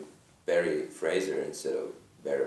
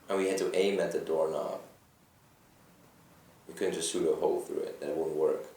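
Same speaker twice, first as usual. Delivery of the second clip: ordinary talk, nothing marked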